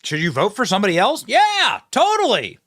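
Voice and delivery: high, loud exaggerated agreement; mock enthusiasm. Host mocking a politician's answer, mock enthusiastic. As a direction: shoot up high on an over-keen yes.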